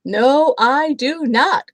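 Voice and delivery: loud mock growl. A narrator's reading. Bear refusing a bath, loud mock growl. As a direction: growl it back, slow and loud. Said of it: "growled Big Smelly Bear".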